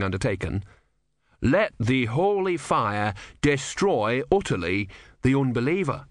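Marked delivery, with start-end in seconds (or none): 1.4-6.1 slow, solemn scripture recital; solemn scripture quote. Acted. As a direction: intone it like holy writ.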